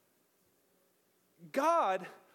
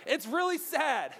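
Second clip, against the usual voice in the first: normal talking voice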